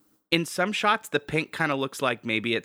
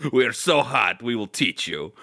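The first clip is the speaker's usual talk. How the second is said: put-on sultry Russian hunk accent. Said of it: "angry Russian crone voice".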